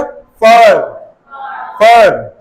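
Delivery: American फर with curled R; American rhotic demonstration of फर; American pronunciation. Shown as a demonstration. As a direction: roll the tongue back for American final R. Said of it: "American के time पे कैसा हो जाएगा".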